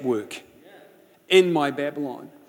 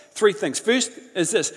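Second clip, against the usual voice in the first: normal talking voice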